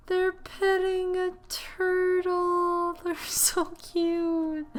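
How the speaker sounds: high, drawn-out coo of delight; gasp, then melt into a slow high squeal; gushing over cuteness. Real, not acted. Spots the kids petting a turtle.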